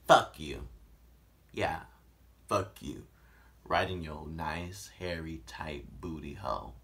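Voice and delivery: low, slow creepy guy. Retold or imitated. Creepy caller line.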